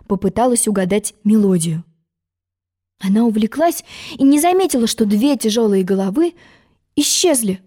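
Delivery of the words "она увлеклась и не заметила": usual speaking voice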